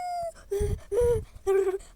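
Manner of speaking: loud high wailing gasp; pained cry. Pretend. Doll wails loudly from the burning drink. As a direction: wail as the heat spreads.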